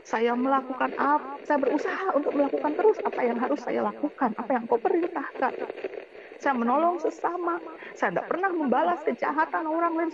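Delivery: plead, then explode on the last word; retold angry lament to God. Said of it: "saya bilang gitu".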